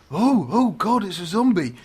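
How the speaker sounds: put-on high-pitched old man's startled fluster; mock fright. Pensioner suddenly spotting a zombie behind him, mock fright. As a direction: gasp it out in pretend alarm. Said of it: "looks around and goes".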